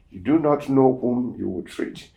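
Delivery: ordinary talk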